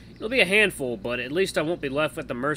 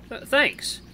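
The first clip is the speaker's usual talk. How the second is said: ordinary delivery, nothing marked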